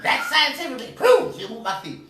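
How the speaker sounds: very high, loud Rasputia voice; Rasputia impression. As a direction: bellow it like Rasputia. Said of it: "Do the voice".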